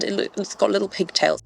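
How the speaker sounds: talking around a cigar; words muffled by a cigar; cigar in mouth. Real, not acted. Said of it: "While we are lighting this cigar up".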